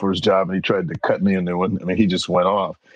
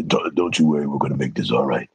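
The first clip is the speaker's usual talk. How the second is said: deep voice; 6 semitones lower than usual. Retold or imitated. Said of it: "real deep voice".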